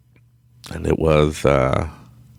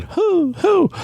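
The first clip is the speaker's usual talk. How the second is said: high, hollow owl imitation; owl hoot; 3 dB louder than usual. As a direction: hoot twice like an owl in the woods. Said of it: "started hearing owls going".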